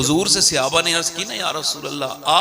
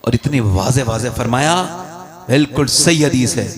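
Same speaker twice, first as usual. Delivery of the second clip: normal talking voice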